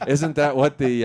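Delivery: usual speaking voice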